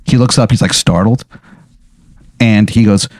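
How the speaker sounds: ordinary delivery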